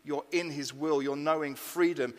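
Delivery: usual speaking voice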